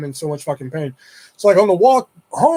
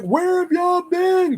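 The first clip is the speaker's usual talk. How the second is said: high, slow call across a distance; 13 semitones higher, much slower than usual. Retold or imitated. Neighbor calls to the boys from across the street, high drawn-out call. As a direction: stretch it out like hailing someone down the street.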